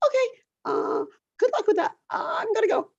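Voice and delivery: high, sing-song oh okay; awkward chirpy retreat; mock polite brush-off. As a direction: squeak it out like a polite escape. Retold own awkward exit.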